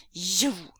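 sputtering outrage; indignant burst; outraged. Acted. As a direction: spit the word out.

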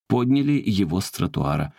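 usual speaking voice

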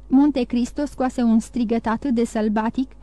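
plain reading voice

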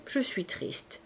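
sad confession, barely voiced. A narrator's reading. Favourite confiding to Dahlia that she is sad, hushed. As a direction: sigh it out, almost silent. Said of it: "Favourite fit une pause".

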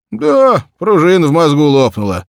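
put-on high, loud, blustering old peasant; gruff peasant Ignat. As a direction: brush it off loudly like a grumbling farmer. Ignat goes on grumbling.

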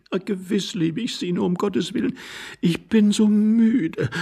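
high, plaintive woman's voice; weary young wife. Acted. Wife fends off her husband, wearily pleading.

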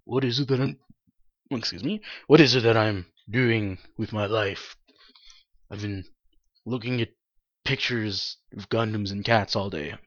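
low, quiet bored sigh. Retold or imitated. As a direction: mumble it like a bored slacker. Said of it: "I'm normally just a little bored".

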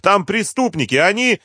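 very high, urgent plea; desperate, strained-high protest; despairing. A narrator's reading. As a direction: plead desperately, get cut off. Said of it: "с отчаянием в голосе".

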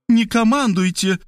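high-pitched, outraged retort; indignant. A narrator's reading. Maria retorts to Markhalyova's order, indignant. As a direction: snap back at being ordered about. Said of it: "возмутилась Мария".

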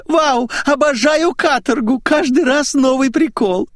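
put-on high, piercing squeal of a silly girl; shrill squealing woman. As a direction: screech it like an excited bird. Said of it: "мамзель визгливо заверещала".

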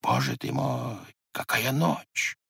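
hushed, awed dread; soft, frightened murmur, drawn out; half-voiced in fear; fearful. A narrator's reading. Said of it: "Со страхом оборотился он".

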